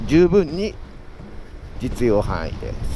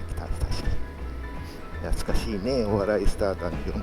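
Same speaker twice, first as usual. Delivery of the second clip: ordinary delivery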